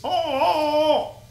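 high, whiny mock sob; mocking whine. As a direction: whine it like a crybaby. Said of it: "Because he's".

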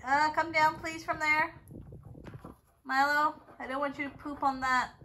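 loud firm order; loud command. Real, not acted. Owner telling Milo to come down, loud firm command. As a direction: call the bird down firmly.

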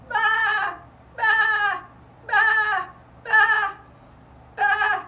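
high, loud bleating baa; loud sheep bleat. Acted. Sheep arrives bleating, loud and high.

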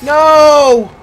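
loud, high, drawn-out cry; anguished shout. Real, not acted. Gamer reacts to dying, loud drawn-out yell. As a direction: yell it in dismay. Player killed in game.

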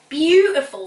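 loud, high, stretched praise; effusive judge's rave; gushing. A narrator's reading. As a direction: gush over the food.

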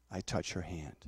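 normal talking voice

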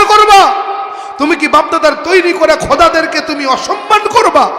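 loud, high-pitched indignant challenge; outraged Abu Jahl. Acted. Abu Jahl leaps up to confront Abu Talib, outraged shouting. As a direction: cry out in outrage, driving up to a shout. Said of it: "Abu Jahl লাফ দিয়ে উঠে কয়".

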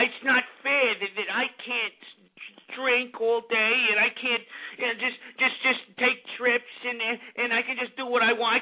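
mock a sore loser's "it's not fair" tantrum; hurt complainer. Host mocks jealous listeners stuck at home, mock-aggrieved. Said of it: "people's feelings get hurt".

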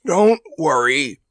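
slow, gruffly brave elephant; gamely grunted. A narrator's reading. Choking elephant reassures Randy, grunted gamely. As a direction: grunt it out slowly, putting on courage. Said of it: "he grunted gamely".

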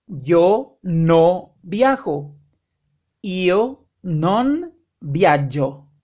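very slow, carefully spaced model phrases; slow example sentences. Shown as a demonstration. Teacher modeling negative sentences, very slow and clear. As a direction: say each word slowly so learners can copy. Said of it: "Por ejemplo".

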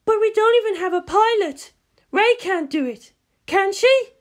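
very high, loud, urgent; alarmed Misato. Acted. Misato protesting to Ritsuko, high and alarmed. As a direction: protest in a panic.

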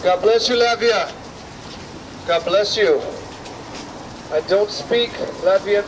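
normal talking voice

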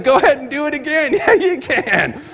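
high, laughing dare; laughing encouragement. Retold or imitated. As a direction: egg him on through laughter. Pastor laughing, urging Joe.

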